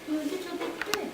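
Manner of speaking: high, soft teacher voice; teacher to little children. Acted. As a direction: gently tell a small child, high and hushed. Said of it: "I said to my students".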